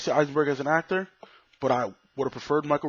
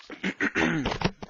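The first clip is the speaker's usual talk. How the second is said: throat clearing; 4 semitones higher than usual. Real, not acted. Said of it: "Excuse me".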